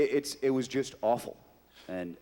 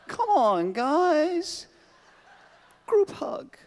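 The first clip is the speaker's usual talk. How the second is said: higher, louder, eager squeal; goofy group hug; 13 semitones higher, 7 dB louder, much slower than usual. Acted. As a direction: beg everyone to hug it out. Joxer clip, high and loud.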